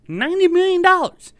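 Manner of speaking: incredulous outburst on dollars; outraged repeat. Real, not acted. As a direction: exclaim the sum in disbelief.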